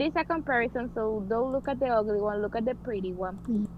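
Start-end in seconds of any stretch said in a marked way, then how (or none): none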